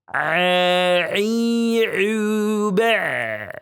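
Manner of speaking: "A, i, u, ba" squeezed from the middle of the throat; pressed throat ain chant; ain drill. Shown as a demonstration. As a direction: tighten the throat on each "A". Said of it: "Huruf ain".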